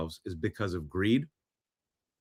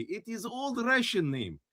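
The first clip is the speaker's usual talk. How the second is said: mock Russian accent, much higher and softer; 11 semitones higher, 4 dB quieter than usual. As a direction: claim the surname in a heavy Russian accent. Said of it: "[name] should do that accent".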